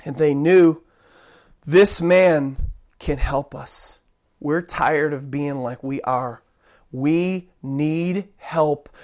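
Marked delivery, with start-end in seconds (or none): 1.6-8.9 low, slow, weary plea; quiet desperation. Acted. Blind men tired of their lives, low desperation. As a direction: weigh each word as if worn out. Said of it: "were in desperation for help".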